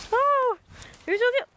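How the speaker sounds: high, loud squeals at the dog; high squealing praise for the dog Dakota; excited pet talk. Real, not acted.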